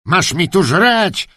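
high, drawn-out angry command; furious yell. Acted. Kennelman ordering the dog to eat, furious. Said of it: "krzyknął".

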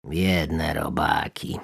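slow, soft, sorry frog voice; drawn-out sigh of pity. Acted. Big frog softly pities the doomed worms.

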